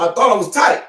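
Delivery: TIGHT punched high and loud; emphatic build-up. Real, not acted. Builds up repeated phrase.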